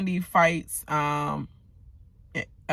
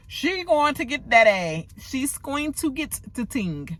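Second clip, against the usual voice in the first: put-on higher-pitched amateur Jamaican accent; put-on Jamaican lilt; attempted Jamaican patois; 6 semitones higher than usual. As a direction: have a playful go at Jamaican patois. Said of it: "I'm trying to learn the patois".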